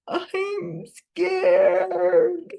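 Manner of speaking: high, frightened wail; scared crying. A narrator's reading. Cornered fugitive on the roof answers cop, tearful and scared. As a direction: cry it out high, like a little kid. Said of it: "for sure was crying".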